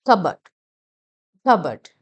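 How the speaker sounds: കബേർഡ് as "KUH-bud", first syllable punched loud; silent P. Shown as a demonstration. Teacher models the word, silent P. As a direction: hit a short KUH in കബേർഡ്, no P. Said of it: "പി silent".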